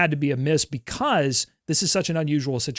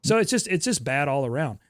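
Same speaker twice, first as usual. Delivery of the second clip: ordinary talk, nothing marked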